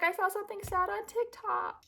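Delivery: very high, small put-on voice; mock-tearful confession. Explaining why she cried, high mock-pitiful voice. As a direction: squeak it out like a hammed-up tearful excuse. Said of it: "And I was like".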